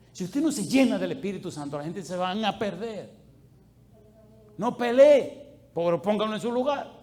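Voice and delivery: full-force sermon shout; fiery preaching. Real, not acted. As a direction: shout the warning at the congregation. Preacher exhorts congregation.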